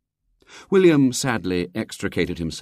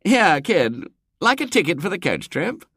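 careless; 10 semitones higher than usual. Acted. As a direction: toss the offer casually to the kid. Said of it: "said carelessly".

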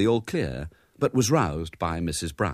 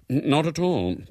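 muffled; 9 semitones higher, slower than usual. A narrator's reading. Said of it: "came the muffled voice".

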